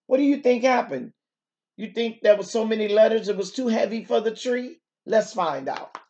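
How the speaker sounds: usual speaking voice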